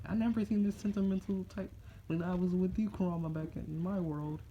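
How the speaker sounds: high put-on teasing voice; teasing character. Naruto teasing Kurama about being sentimental, high and needling.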